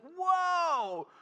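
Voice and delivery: very high, drawn-out amazed whoa; wife gasping at mountains; awed whoa. Retold or imitated. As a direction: imitate wife stunned by the view. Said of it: "She'd be like".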